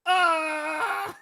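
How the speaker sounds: loud, high comic scream; hammed-up falling scream; mock plane-crash scream. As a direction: scream as if the plane is going down, breaking into laughs. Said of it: "While he's crashing his plane".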